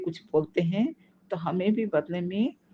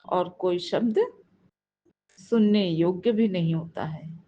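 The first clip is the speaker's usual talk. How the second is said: ordinary talk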